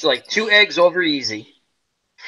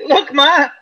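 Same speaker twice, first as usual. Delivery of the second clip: put-on higher and louder, a child's showing-off call; mock boast; 11 semitones higher, 5 dB louder, much slower than usual. Joking about his own pill mishap, excited mock boast. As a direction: shout it like a kid on a bike.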